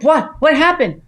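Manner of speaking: high, loud startled questions; jolted awake and alarmed; husband woken suddenly, high loud alarmed questions. Retold or imitated. Husband's startled reply voiced.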